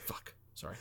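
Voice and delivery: ordinary delivery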